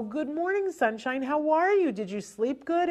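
high, bubbly rush of words; gushing greeting. Shown as a demonstration. Parent gushing a flood of words at the child. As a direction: gush over the child all at once. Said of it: "we have a tendency to go".

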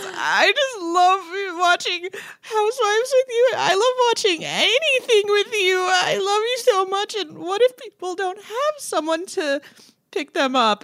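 high, weepy mock crying voice; imitating sobbing sedated girlfriend. Imitating the girlfriend crying over her love, high weepy voice. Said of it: "she goes".

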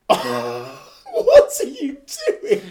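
loud, drawn-out cry through laughter; incredulous laughing. Real, not acted. Podcaster reacting to his co-host's mishap, laughing loudly. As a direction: blurt it out while cracking up. Co-host's slip cracks him up.

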